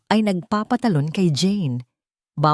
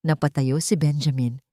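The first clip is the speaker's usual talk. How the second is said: usual speaking voice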